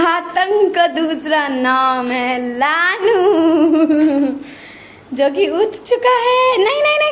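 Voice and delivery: playful, over-the-top villain introduction; mock-dramatic announcement. Mock-dramatic introduction of waking toddler.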